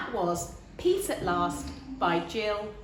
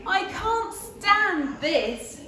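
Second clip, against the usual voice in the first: slow, higher, louder weary complaint; fed-up Mister Bear; 9 semitones higher, 4 dB louder, much slower than usual. Acted. Mister Bear, kept awake, moans slowly and loudly. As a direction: drag it out, fed up and sleepless. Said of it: "said Mister Bear".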